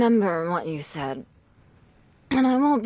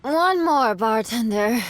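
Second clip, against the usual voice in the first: put-on a slightly drunk call for another round; tipsy call; 2 semitones higher than usual. Drunk woman calling the bartender for one more. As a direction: slur out an order, a bit too eager.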